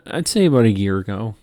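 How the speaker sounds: ordinary talk, nothing marked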